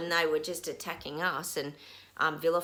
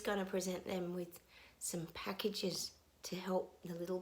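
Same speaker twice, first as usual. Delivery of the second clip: whispered; 7 dB quieter than usual. Real, not acted. Mocking government wording.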